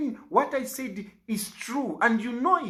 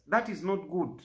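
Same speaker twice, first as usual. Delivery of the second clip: usual speaking voice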